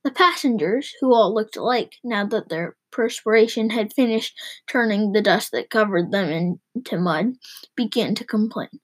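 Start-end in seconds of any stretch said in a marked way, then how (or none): none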